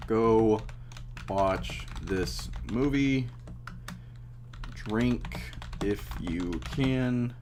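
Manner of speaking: tipsy slow slurred speech. Real, not acted. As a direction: talk like you've had a few. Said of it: "I have been drinking if you can't tell".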